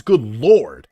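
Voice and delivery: loud, startled good lord; surprised exclamation. Real, not acted. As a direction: blurt it out in shock. Reacting to Great Danes.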